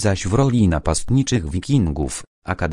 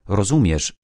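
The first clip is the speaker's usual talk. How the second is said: ordinary delivery, nothing marked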